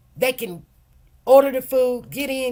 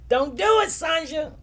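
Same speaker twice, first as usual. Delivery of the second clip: playful warning; 6 semitones higher than usual. Real, not acted. Calling out to viewer.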